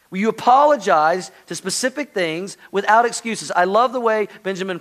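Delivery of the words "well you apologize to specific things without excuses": normal talking voice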